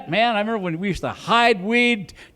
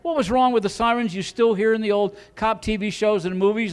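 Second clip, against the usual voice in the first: ordinary talk, nothing marked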